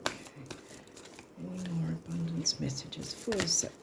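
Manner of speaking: soft unvoiced whisper while shuffling. Real, not acted. Reader whispering to herself while drawing cards in a whisper. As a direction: hush it all into a private whisper.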